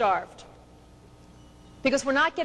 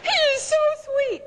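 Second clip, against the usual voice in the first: put-on much higher, excited girlfriend gushing; gushing wife; 11 semitones higher, faster than usual. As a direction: squeal it to your friends on the phone. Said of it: "She's calling up all her friends".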